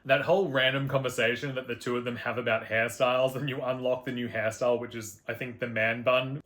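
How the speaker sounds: ordinary talk, nothing marked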